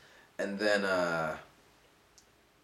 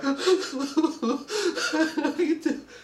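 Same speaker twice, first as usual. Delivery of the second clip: laugh. Real, not acted. Laughing at imagined reaction.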